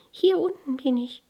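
high, small flower voice; little flower calling. A narrator's reading. Tiny flower answers the girl, calling up. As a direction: pipe up from below in a high voice. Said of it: "rief die kleine Blume".

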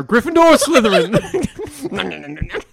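slow, high, grand sorting hat. Retold or imitated. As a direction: drag it out like the magic hat. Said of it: "pulls out the fucking hat from Harry Potter".

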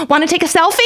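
high, over-bright mock offer; awkward escape offer. Idol to a crying fan, awkwardly upbeat. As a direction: chirp it to escape an awkward moment.

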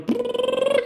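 very high rolled "brrr"; engine trill. Retold or imitated. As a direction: imitate a plane engine starting takeoff. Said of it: "And the pilot goes".

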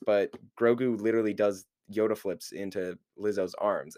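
ordinary delivery

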